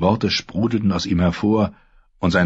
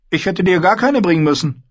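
much higher, louder sister lecturing her brother; Asha, cool and scornful; 12 semitones higher, 4 dB louder than usual. Acted. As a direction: talk down to him, sharp and sure. Asha retorts.

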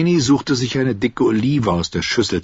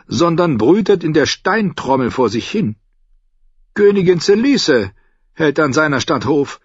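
higher, louder lilting merchant voice; a sly, friendly trader agreeing; Lyseni captain; 9 semitones higher, 4 dB louder than usual. Acted. Salladhor answering Davos in his raised merchant voice.

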